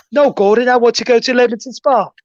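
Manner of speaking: high, put-on English accent; English guy accent. Imitating the player backing out of the move, mock English accent. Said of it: "when he's doing his English guy".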